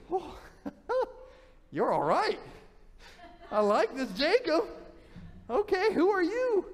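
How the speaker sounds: charmed and laughing at the stranger; delighted young woman. Acted. Rachel meets Jacob at well.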